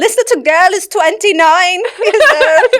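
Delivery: loud, high mock-Jamaican exclamation; Jamaican accent. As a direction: play an amused Jamaican nurse, laughing. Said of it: "That's my Jamaican".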